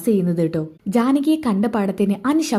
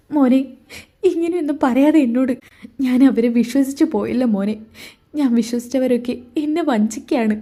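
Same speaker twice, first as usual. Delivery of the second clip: higher, choked tearful voice, close to crying; tearful mother; 4 semitones higher than usual. Acted. Mother to son after overhearing the plot, tearful. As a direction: heartbroken mother holding back tears. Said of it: "അമ്മ കരയാണോ?".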